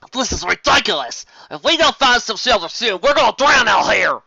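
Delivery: loud exasperated cry; frustrated yell. Acted. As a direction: rant against the downpour. Soaked pony yells in the storm.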